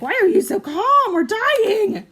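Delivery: high, loud panicked shriek; shrieking anxiety voice. Retold or imitated. Her anxiety shrieks at her, high and loud panic. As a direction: shriek it like your own panic talking. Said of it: "my anxiety would be like".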